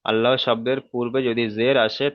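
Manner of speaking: usual speaking voice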